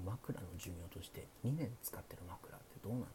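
hushed late-night chat, often whispered; sleepy. Pretend. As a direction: talk softly like the listener is drifting off. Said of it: "寝落ち配信なんでね". (小声でささやく寝落ち配信トーク)

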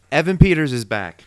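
loud, high reveal of the big news; excited announcement. Real, not acted. Host announcing the return to his co-host, excited. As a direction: announce it like a headline.

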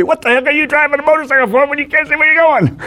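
fast, high-pitched imitation of a telling-off; high, hurried mock rant; mock yelling. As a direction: play an outraged judge, rattled off high. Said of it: "he was gonna yell at me".

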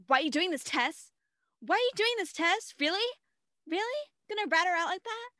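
high, loud indignant mock voice; mock outraged Caitlin. Caitlin confronting Tess, high mock outrage. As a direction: protest in a high shocked voice. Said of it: "Caitlin's like".

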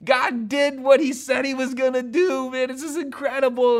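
high, loud astonished outcry; hammed-up amazement, shouted high; astonished laughing. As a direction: burst out in disbelief and joy, laughing. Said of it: "It's astonished laughing".

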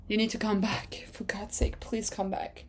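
hushed, half-whispered mock begging; whispered plea. Fan begging team boss to return, whispered plea. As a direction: beg under your breath, very softly.

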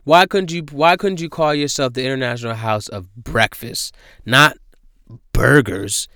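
ordinary talk, nothing marked

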